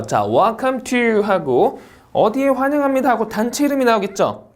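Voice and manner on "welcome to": "Welcome to" as one higher chunk; linked phrasing. Shown as a demonstration. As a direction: run "Welcome to" together without a break. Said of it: "묶어읽기 한번 봐볼게요". ("Welcome to"를 묶어 읽기)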